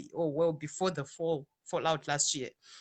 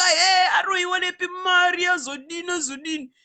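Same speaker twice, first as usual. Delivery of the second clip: higher and louder, crying out in outrage; mock scolding shout; 10 semitones higher, 6 dB louder than usual. Retelling how church people scolded her, loud mock outrage. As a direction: shout like scandalised church folk.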